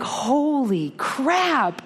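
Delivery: high, slow, stunned disbelief. Retold or imitated. Realizing the girl on the ankle is her, stunned and slow. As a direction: drag it out, frozen in shock. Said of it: "stone cold in a freeze".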